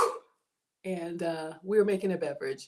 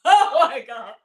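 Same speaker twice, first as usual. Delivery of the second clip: excited exclaim; 4 semitones higher, 10 dB louder than usual. Real, not acted. Reacting to a donation.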